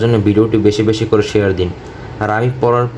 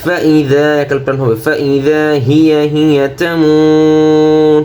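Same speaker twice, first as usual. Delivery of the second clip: slow melodic tajweed chant; Quran recitation; 5 semitones higher, much slower than usual. Shown as a demonstration. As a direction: sing the verse with held vowels. Model recitation.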